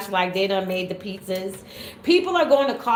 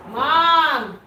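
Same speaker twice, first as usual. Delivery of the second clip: calling out; 6 semitones higher, 8 dB louder than usual. Real, not acted. Calling for her mother.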